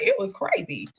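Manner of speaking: usual speaking voice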